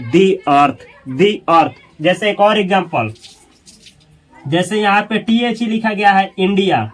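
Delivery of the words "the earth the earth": "the earth" said as dee arth; long ee in "the" before "earth". Shown as a demonstration. Teacher shows the before a vowel, pronounced dee. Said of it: "इसलिए इसको पढ़ा जाएगा".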